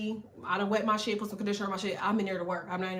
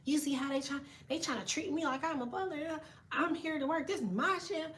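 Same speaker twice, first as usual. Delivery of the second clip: a hushed, higher, sulky mimic of the coworkers, softer; mocking coworkers; 4 semitones higher, 7 dB quieter than usual. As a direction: mimic the grumbling staff under your breath. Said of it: "They just like".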